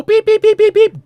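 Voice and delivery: loud high mock scanner beeping; scanner beeps. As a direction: chirp it out like a barcode gun. Imitating a retail scanner.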